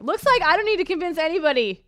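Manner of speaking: high, loud mock triumph; mocking retort. Host mocking a hate-listening fan, high loud gloating.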